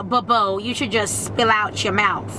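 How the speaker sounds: normal talking voice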